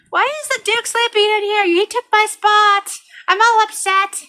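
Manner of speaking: high, whiny mock pet voice; upset cat. Cat complaining his bed spot was taken, mock upset. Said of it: "and so he's like".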